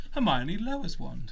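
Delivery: ordinary talk, nothing marked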